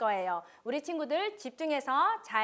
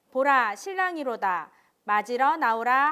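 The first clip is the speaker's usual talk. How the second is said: loud call; 7 dB louder than usual. Acted. Midnight cry in parable.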